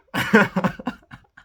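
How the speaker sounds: high loud cackle; explosive high laugh; loud burst of laughter. Real, not acted. Laughing at own bad news example.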